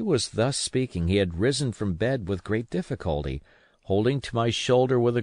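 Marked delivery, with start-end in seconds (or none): none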